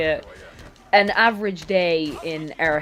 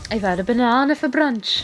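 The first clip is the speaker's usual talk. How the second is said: put-on higher, playful Welsh accent; 4 semitones higher than usual. Trying an accent they love, mock Welsh accent. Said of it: "I find it really hard to do a Welsh accent".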